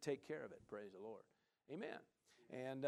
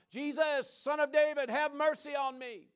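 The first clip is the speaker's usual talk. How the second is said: high, loud desperate cry for mercy; screaming for mercy; 9 semitones higher, 8 dB louder than usual. Retold or imitated. Blind beggar calls out to Jesus by the road, loud desperate cry. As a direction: cry out to be heard over the crowd. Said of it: "So he starts screaming out loud".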